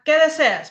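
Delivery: P=A; high stressed qué question. Shown as a demonstration. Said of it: "Preguntas directas".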